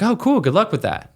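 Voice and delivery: high-pitched "oh", then dropping off dismissively. Retold or imitated. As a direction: imitate a politely dismissive brush-off. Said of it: "she's dismissive".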